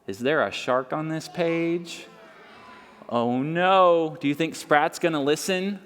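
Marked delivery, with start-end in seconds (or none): none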